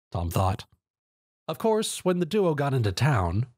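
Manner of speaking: ordinary delivery, nothing marked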